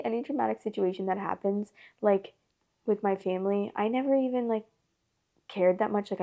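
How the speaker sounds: ordinary talk, nothing marked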